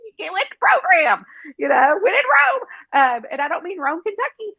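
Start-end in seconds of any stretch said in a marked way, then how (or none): 0.1-2.6 sassy retort. Retold or imitated. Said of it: "And I'm like".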